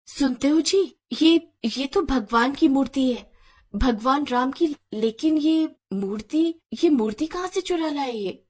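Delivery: hesitant, stunned questioning; shocked wife. Acted. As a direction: falter in astonishment at what you see. Wife shocked to see god's idol.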